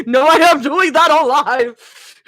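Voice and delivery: high, loud, drawn-out proclamation; hammed-up shout; loud retold declaration. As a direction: blare it out in mock defiance. Retells own loud reaction.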